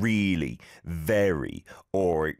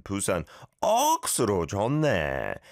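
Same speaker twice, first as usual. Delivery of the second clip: 억수로 stressed, fast rhythmic exclamation; Busan accent; much faster than usual. Shown as a demonstration. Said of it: "you emphasize the ok, and it sounds like the exclamation".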